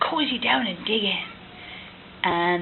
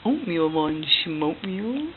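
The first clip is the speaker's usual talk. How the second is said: slow silly rhyme; 6 semitones lower, 5 dB quieter, slower than usual. Pretend. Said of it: "That's some good rhyming".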